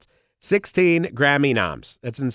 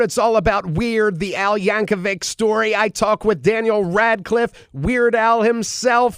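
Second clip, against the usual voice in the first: high, loud, pumped-up promo read; hyped announcer; 12 semitones higher, 6 dB louder than usual. Acted. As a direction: sell it like a show opener. Hyped recorded show intro.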